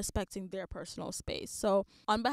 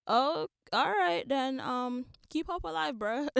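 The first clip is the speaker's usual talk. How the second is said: dismissive brush-off; 4 semitones higher, 4 dB louder, slower than usual. Retold or imitated. Herself brushing off a stranger.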